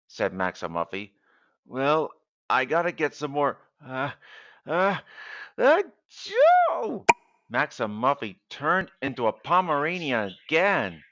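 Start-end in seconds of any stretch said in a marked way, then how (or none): 1.6-7.0 building sneeze. Acted. Sick man stammers before a big high sneeze. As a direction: let the sneeze build and burst.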